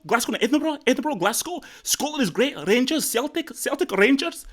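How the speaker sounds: high-pitched, hammed-up Scottish accent; attempted Scottish accent. Shown as a demonstration. Shows off his Scottish accent to win a contest, hammed-up Scottish. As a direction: burst in loud, then keep a high, exaggerated Scottish lilt. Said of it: "this is my Scottish accent".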